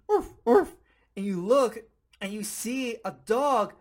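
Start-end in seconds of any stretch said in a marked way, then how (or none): 0.1-0.7 high, loud yappy bark; imitated dog bark. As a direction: bark like a little dog, much higher and louder. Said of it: "you hear some barking".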